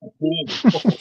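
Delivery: pennies in a broad Boston accent; Boston accent that makes pennies sound like panties. Real, not acted. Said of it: "it's my Boston accent".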